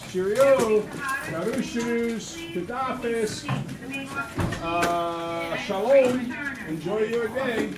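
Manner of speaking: loud, high, playful string of farewells; hammy booming goodbyes in many languages; exuberant goodbyes. Real, not acted. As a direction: send the class off with a big cheery sendoff. Teacher's exuberant farewell to class.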